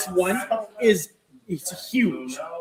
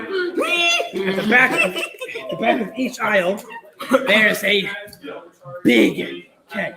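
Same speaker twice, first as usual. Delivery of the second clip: laughing; 3 semitones higher, much slower than usual. Acted. GM laughs through shopkeeper description.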